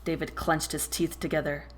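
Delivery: plain reading voice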